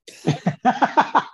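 laughs out loud, high-pitched; a sudden high laugh at the joke; high burst of laughter. Real, not acted.

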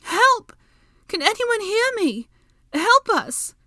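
very high, loud calls for help; continued shouting. A narrator's reading. Hermione calling for anyone to hear, high shouting. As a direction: keep calling out at high pitch. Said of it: "Hermione kept on".

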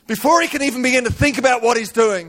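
high-pitched dramatic sermon telling; impassioned preaching. Acted. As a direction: preach the story at full pitch. Preaches at full force.

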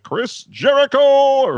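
Chris Jericho stretched out high and loud, like a ring announcer; booming announcer intro of Chris Jericho; ring announcer call. Shown as a demonstration. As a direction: belt out Chris Jericho like announcing him to the arena. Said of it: "You gotta be like".

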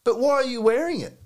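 high retold question with a smile; smirking young lad. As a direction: imitate a lad needling someone. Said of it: "had a smile on his face".

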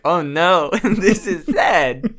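high, loud, laughing through it; laughing mock sad. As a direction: laugh while pretending to tear up. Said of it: "I'm like".

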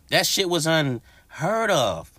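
slow and high, leaning on that; drawn-out high emphasis on that; emphatic disbelief. Real, not acted. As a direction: spell it out slowly so it lands.

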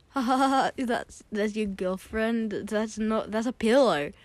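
loud mock laugh into disbelieving words; acted laughing outburst. Retelling laughing at his friend's pillow girlfriend, mock laughing. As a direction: burst into put-on laughter, incredulous. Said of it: "I burst out in laughter".

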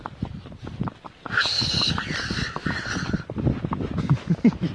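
a long, airy laugh under the breath; long breathy laugh. Real, not acted. Owner laughing at her upset dog, breathy laugh.

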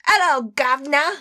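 high, put-on British Cockney greeting; Cockney accent. Shown as a demonstration. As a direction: chirp it in hammed-up Cockney. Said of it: "Get into your British voice".

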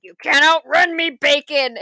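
loud, hammed-up threat; mock menacing shout. Nebula chasing Kevin Bacon, a hammed-up loud threat. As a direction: bellow it like a hunter chasing prey. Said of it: "Nebula's just like".

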